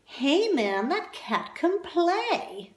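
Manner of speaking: slow, high hipster drawl; hammed-up jazz cat voice; cool jazz cat. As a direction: lay it on like a beatnik. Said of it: "this person here said".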